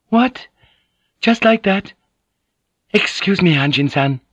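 high, taken-aback lady's voice; polite but thrown, pitch jumping up; flustered. A narrator's reading. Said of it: "she said, flustered".

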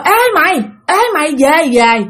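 high retold calling across the water; grandma hollering at her friend. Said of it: "bà ngoại cứ kêu là".

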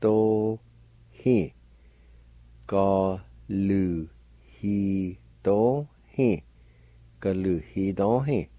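"Galuhidohi" very slowly drawn out, faint H before the Y; slow model of "Galuhidohi"; slow Cherokee pronunciation. Shown as a demonstration. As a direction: stretch "Galuhidohi" out for learners. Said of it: "It's pronounced like this".

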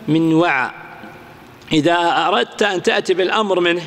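normal talking voice

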